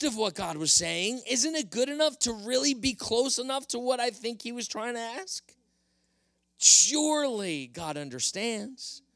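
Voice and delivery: king wheedling his way out of blame; insisting Saul. Acted. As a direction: insist you did nothing wrong. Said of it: "Saul insisted".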